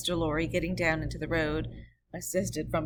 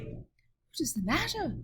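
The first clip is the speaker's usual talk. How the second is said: higher, slow, a touch of a quaver; mildly quavering; 11 semitones higher, slower than usual. Acted. Mr Lorry asking the rider, mildly quavering. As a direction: ask it a little unsteadily. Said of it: "with mildly quavering speech".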